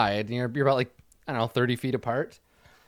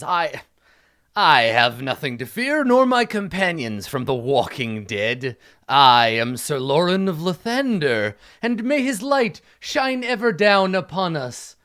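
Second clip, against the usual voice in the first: slow, grand proclamation; pompous knight; 3 semitones higher, 6 dB louder, slower than usual. Acted. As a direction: declare your faith like a stately knight.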